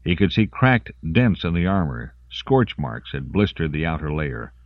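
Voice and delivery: ordinary delivery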